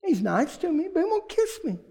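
a hint of a fretting woman; woman's voice. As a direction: voice her plaintive grumble. Pastor voices a woman's complaint.

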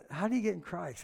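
ordinary talk, nothing marked

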